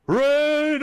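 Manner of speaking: sudden high, loud yell. Acted. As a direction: shout the name right behind her. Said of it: "he's gonna yell".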